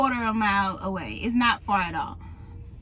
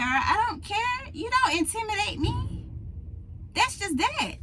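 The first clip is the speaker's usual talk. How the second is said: defiant; 8 semitones higher than usual. Real, not acted. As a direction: plant your feet, refuse to back down. Defiant about not being scared.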